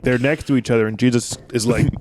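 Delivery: normal talking voice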